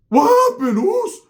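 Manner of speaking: very high, loud comic shout; hammed-up shriek of alarm; startled yell. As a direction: holler it like you just got smacked. Jumps up dazed after being hit.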